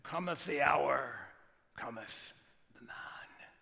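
put-on slow, grand British accent fading to a hush; slow solemn British accent, dying away. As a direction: proclaim it in a British accent, then let it drop. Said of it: "in this beautiful British or Scottish accent".

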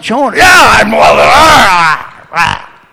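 loud, high mock-angry yell; hammed-up shout breaking into wordless roaring; Jonah-like outburst. Angry pastor answering God as Jonah would, mock outburst. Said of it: "And I'm like".

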